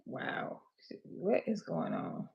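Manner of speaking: muttered. Real, not acted. Audio failing on live stream.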